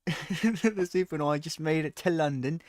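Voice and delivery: loud, high hammed-up British accent; over-the-top fake cockney; mock British. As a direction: belt the caption like a cartoon Londoner. Final take of caption voice.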